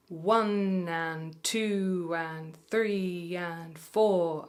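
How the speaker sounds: very slow, drawn-out counting in time. Shown as a demonstration. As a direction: count each beat slowly. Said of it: "I'm gonna do that one again".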